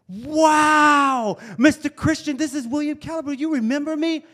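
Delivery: high, loud joyful shout; overjoyed yell down the phone; excited shout. Retold or imitated. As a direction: burst out with thrilled recognition. Excited reunion call on phone.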